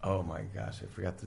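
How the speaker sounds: soft, dropped-away mock realization; sheepish aside. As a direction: let it fall quiet as if just remembering. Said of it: "He goes".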